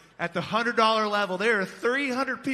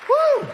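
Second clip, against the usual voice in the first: high loud whoop; whooping cheer; 9 dB louder than usual. Real, not acted. Auctioneer cheering the donors, high whoop. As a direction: let out a big celebratory woo.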